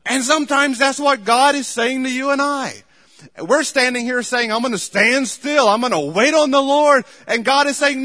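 loud, high, driving pulpit preaching; preaching at full force. Acted. Pastor exhorting his church, preaching at full force. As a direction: hammer the point home to the congregation. Fiery sermon on waiting.

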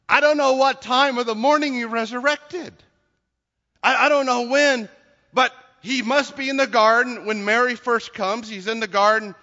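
forceful preaching. Real, not acted. Preacher to congregation on the risen Jesus in the garden, forceful.

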